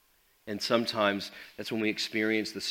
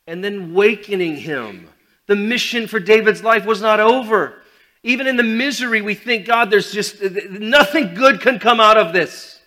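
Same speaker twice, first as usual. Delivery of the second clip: fiery preaching; 10 semitones higher, 15 dB louder than usual. Acted. Preaching at full force.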